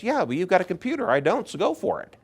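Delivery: easygoing friend. Retold or imitated. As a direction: wave him on cheerfully. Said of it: "He goes".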